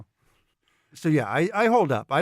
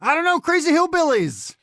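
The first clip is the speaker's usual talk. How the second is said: very high, loud frantic answer; panicked yell; 14 semitones higher, 6 dB louder than usual. Acted. Fleeing runner answering confused bandmates, panicked yell. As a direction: shout back in a panic. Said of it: "in a panic".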